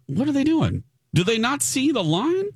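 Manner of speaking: high-pitched disbelieving questions; incredulous. Retold or imitated. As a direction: ask it in stunned disbelief, high. Acted incredulous reaction to queue jumpers.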